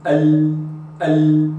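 ال repeated with no hamza before it; lam without a glottal stop. Shown as a demonstration. Teacher showing viewers ال without a hamza. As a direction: say ال straight from the tongue tip, no catch before it. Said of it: "هكذا".

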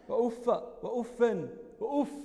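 أفا as "uffa", "uffan", then bare أف "uff"; fatha, nunation, then sukun. Shown as a demonstration. Teacher listing dialect forms of أف slowly, "uffa, uffan, uff". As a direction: slowly end in a, an, then no vowel. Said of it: "والفتح والتنوين".

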